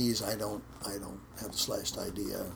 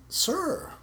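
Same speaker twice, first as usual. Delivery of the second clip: pleading sir; 4 dB louder than usual. Retold or imitated. Pleading with teacher.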